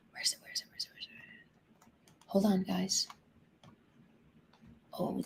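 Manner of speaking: hushed, barely voiced whisper. Real, not acted. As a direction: whisper to yourself while hunting on screen. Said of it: "I don't know why I'm whispering".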